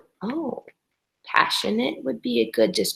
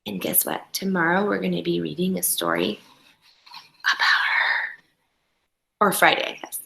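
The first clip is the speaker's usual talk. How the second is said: soft unvoiced whisper; 4 semitones lower, 6 dB quieter than usual. Real, not acted. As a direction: share a secret with the class. Teacher whispers aside to class.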